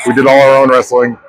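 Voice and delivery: usual speaking voice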